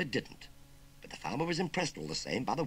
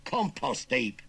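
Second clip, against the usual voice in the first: loud, higher Cockney retort; blunt correction; 5 semitones higher, 7 dB louder, much slower than usual. Acted. Nephew explaining where uncle will land, blunt. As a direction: spell out the threat loudly.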